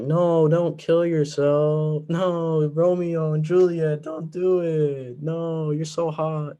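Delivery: high, slow, drawn-out pleading; hammed-up plea to Romeo and Juliet; mock pleading with the lovers. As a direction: beg the doomed teens in a mocking whine. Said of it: "It's like".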